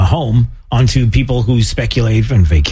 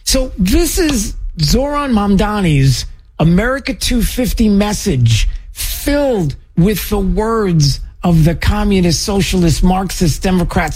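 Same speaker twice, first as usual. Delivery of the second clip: higher pitched, slowed disbelief at every key word; incredulous exasperation; 7 semitones higher than usual. Real, not acted. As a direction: sound like you can't believe what you heard. Host mocks rival's message, exasperated.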